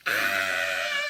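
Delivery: low, loud bellowed scream; a deafening terrified scream; loud low howl of terror. A narrator's reading. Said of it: "let out a deafening scream".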